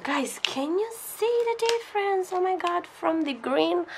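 high, eager and amazed, asking viewers to look; excited squeal. Real, not acted. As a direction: squeals as the color suddenly turns. Colors change while she mixes.

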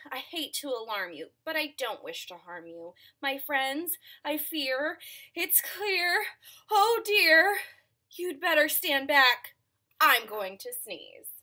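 high and loud worried elephant. Acted. Elephant voiced for children.

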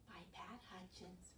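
hushed whisper of the author. Acted. Teacher whispering author's name to children. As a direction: whisper it like a secret.

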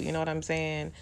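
ordinary talk, nothing marked

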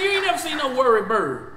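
normal talking voice

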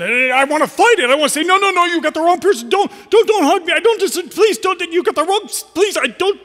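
high, loud, desperate protest; frantic pleading. Retold or imitated. Preacher reliving refusing Jesus's hug, loud high pleading. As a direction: beg him not to hug you, voice cracking high. Pleading against being hugged.

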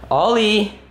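drawn-up, carrying call. Acted. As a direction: call the dog over from a distance. Calling the dog to come.

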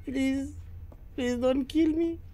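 high frightened pleading; beg, genuinely scared; scared horror game streamer. Real, not acted. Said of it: "I'm so afraid".

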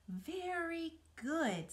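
high, slow encouraging praise. Acted. Charlotte praises Wilbur's climb, slow and high. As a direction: praise him gently, stretched out.

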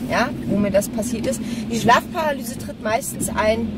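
usual speaking voice

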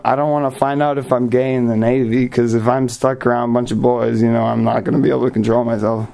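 ordinary talk, nothing marked

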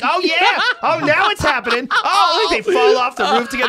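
high, loud, laughing glee; gleeful raven. Retold or imitated. Voicing the raven gloating over the cat fight, high and loud. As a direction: cackle with delight as the fight starts. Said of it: "He's like".

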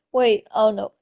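ordinary delivery

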